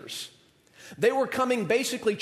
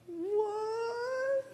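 sudden high, startled what; surprised what; 7 dB quieter than usual. Retold or imitated. Student stunned at his lira fortune, startled high what. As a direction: squeak it out in disbelief. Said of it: "He's like".

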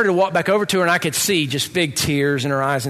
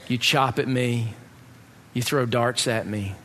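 softer and lower, gently repeating "I love you anyway"; tender, tearful love; 7 semitones lower, 5 dB quieter than usual. Retold or imitated. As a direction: let each "anyway" land softly, like forgiving a friend. Crying friend's loving confession.

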